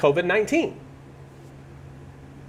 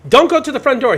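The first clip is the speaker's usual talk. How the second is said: loud, high warning shout at the courier; loud warning yell; 7 semitones higher, 6 dB louder than usual. Retold or imitated. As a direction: call out loud and high to stop him. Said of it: "And I yell at the FedEx guy".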